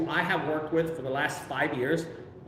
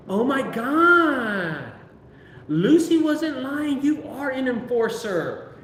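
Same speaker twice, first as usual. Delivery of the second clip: higher, louder and slow, mock awe; amazed lady; 7 semitones higher, 4 dB louder, much slower than usual. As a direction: play the impressed property lady. Amazed lady praises him.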